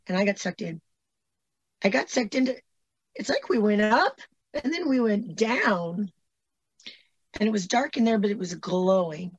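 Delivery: normal talking voice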